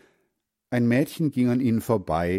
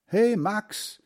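high-pitched call to a friend; 11 semitones higher than usual. A narrator's reading. Boy hails little Max in the street, calling out. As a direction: call his name out high, without shouting. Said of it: "rief Hans Frieder".

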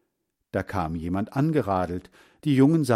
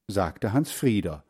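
ordinary delivery

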